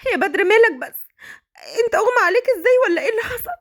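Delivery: high and desperate, calling for help; panicked wife. Acted. Wife finds husband collapsed. (صوت زوجة مذعورة تستغيث)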